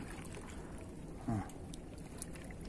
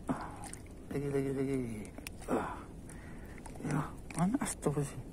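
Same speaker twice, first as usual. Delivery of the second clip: whisper; 11 dB quieter, faster than usual. Real, not acted. Quiet moment handling fish.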